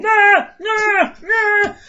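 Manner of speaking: put-on very high, far, nasal-free childish drone; high whiny kid imitation; annoying kid noise. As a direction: mimic an annoying little kid. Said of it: "he's just sitting there going".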